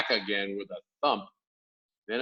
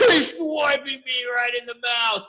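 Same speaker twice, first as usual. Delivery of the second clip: high, loud pained yell; 13 semitones higher, 8 dB louder than usual. A narrator's reading. Injured giant yelling about being hit. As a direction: yell out in outrage. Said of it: "yelled the meat dripper".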